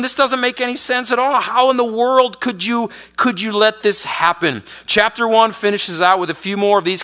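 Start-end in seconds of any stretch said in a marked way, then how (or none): 0.0-4.6 higher and fast, bewildered; bewildered prophet. Retold or imitated. Habakkuk bewildered at God, voice high and quick. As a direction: pour out confusion. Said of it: "He's going".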